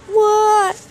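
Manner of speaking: high squeaky toy voice answering; high plush voice. Pretend. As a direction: play the grumpy plush brother.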